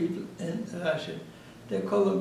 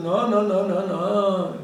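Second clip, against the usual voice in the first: louder insistent no, no, no; retold loud refusal to the partner; firm loud refusal; 7 dB louder, slower than usual. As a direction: shut him down loudly.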